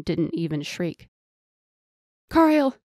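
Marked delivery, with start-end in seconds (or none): 2.3-2.8 high, loud cry of a name; alarmed high-pitched shout; screamed. A narrator's reading. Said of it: "Janir screamed".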